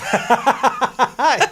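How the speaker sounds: loud, high burst of laughter; real high-pitched belly laugh. Real, not acted. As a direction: crack up loudly. Laughing at co-host's joke.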